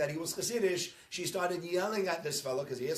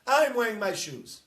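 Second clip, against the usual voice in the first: mock-indignant, louder and higher; indignant protest; 4 semitones higher, 9 dB louder than usual. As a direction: play the offended worshipper defiantly. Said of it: "they say".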